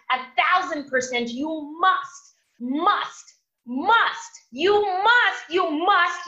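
preacher rising to a climax on you must; emphatic repetition. Real, not acted. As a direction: hammer home every must. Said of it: "and if I may repeat".

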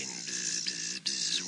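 low, soft buzzing; low soft bzz bzz; buzzing bee. Acted. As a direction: buzz like a lazy bee.

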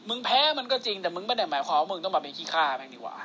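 low, measured fatherly advice; wise elder mentor. Acted. Acted elder advising the junior.

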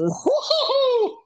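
slow, squealing woo-hoo; high mock cheer.

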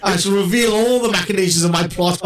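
hammy gloating villain, laughing; mustache twirling villain. Pretend. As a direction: gloat like a pantomime baddie. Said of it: "I love mustache twirling villains".

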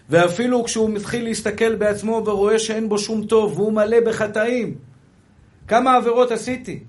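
loud, insistent sermon reading; ringing declamation; full-force preaching. Acted.

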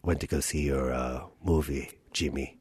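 low, very soft dad voice; father. Acted. Playing castmate's dad in improv, low and hushed. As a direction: play a deep, hushed father. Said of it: "I'm gonna play your dad".

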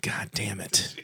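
whisper. Real, not acted. Muttered after call.

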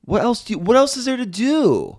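high, loud mock disbelief; high exclaiming. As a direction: squeal it in disbelief. Mock outrage at skincare.

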